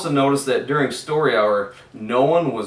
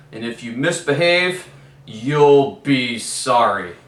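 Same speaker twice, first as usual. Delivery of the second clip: menacing teacher; 6 dB louder than usual. A narrator's reading. Miss Swamp threat, slowed.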